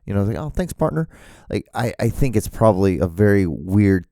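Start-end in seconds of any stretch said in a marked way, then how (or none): 0.4-1.1 high, friendly "thanks, partner"; a cheerful country thank-you; cheery cowboy thanks. Retold or imitated. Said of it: "a guy like me who'd be like".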